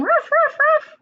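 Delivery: high, loud "ruff" barks; barking dog. Acted. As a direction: bark like an excited dog. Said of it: "they're all barking and yelling".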